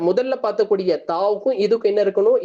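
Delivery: normal talking voice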